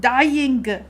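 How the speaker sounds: loud emphatic outburst; exclaimed. Real, not acted. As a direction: blurt it out with drama. Exclaims after saying dying.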